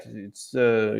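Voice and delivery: usual speaking voice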